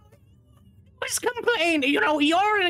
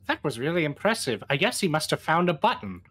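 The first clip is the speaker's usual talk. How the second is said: inner thought voice; 9 semitones lower than usual. Acted. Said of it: "Pi internally thinks".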